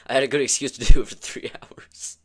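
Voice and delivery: loud burst of laughter through the words; cracking up mid-sentence; laughing. Real, not acted. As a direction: laugh while getting the excuse out. Laughing at own excuse.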